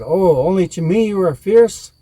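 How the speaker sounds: loud, high taunting cry; defiant Coyote. A narrator's reading. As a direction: call it out boldly as Coyote.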